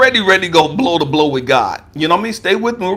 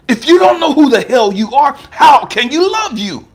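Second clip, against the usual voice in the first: raised challenge; 7 semitones higher, 5 dB louder than usual. Retold or imitated. God challenges him loudly.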